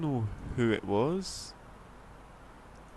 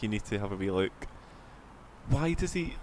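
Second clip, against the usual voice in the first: ordinary talk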